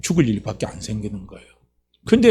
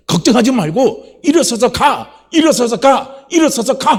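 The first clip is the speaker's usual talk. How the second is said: high, loud rallying command; impassioned shouted command, climbing in pitch; urgent exhortation; 9 semitones higher, 5 dB louder, slower than usual. Acted. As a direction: urge them onto their feet. God urges the person to rise.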